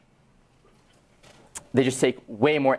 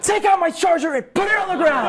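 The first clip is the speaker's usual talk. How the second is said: much higher, louder laughing demand; laughing shout; 5 dB louder than usual. Retold or imitated. Student demanding his charger back too, laughing shout. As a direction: shout it with a laugh, pushing luck.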